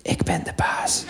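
slow breathy whisper; soft, slow whispered command. Retold or imitated. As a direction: whisper it into the dog's ear. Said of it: "ik fluister in zijn oortjes".

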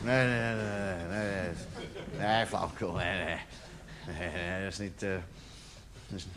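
very low, hushed muttering; quiet, low self-soothing murmur; little inner voice. Acted. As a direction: mumble denials to yourself, barely audible. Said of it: "zo'n stemmetje in je achterhoofd".

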